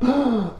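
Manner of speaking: sharp mock gasp; shocked gasp. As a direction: suck in air as a startled crowd. Acting out everybody's shocked reaction.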